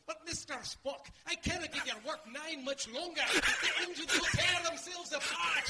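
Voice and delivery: high, hammed-up Scottish engineer; Scottish accent. Shown as a demonstration. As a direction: cry out in a Scottish accent, building to a shout. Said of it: "That's Scottish".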